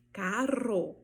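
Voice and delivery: CARRO with a long tongue trill; Spanish rolled RR. Shown as a demonstration. Teacher repeating Spanish carro, rolled RR. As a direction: vibrate the double R in carro, end on O. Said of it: "em espanhol eu falo".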